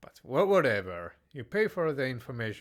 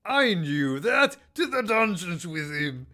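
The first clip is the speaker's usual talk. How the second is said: mock royal outburst; 6 semitones higher, 6 dB louder than usual. King jails the flattering guard in hammed-up fury. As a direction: bark the sentence like a vain tyrant king.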